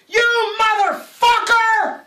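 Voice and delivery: high, loud, slow furious shriek; old man screaming. Acted. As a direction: let the old man explode. Old juror second outburst.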